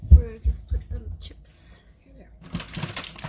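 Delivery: voiceless whisper aside. Real, not acted. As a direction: whisper it to yourself. Aside while plating chips.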